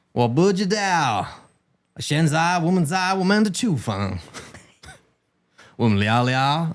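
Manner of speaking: put-on slow, drawling cowboy accent over Mandarin; cowboy accent in Chinese. Imitating a cowboy accent while speaking Chinese to his wife. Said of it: "Is that a cowboy accent with a Zhongwen".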